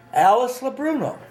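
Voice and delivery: insistent, high-pitched name call; teacher's roll call. Retold or imitated. Teacher calling a third time as nobody answers, insistent. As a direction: pitch the name right up, searching for the pupil. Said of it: "he's calling a roll call".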